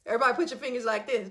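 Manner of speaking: ordinary delivery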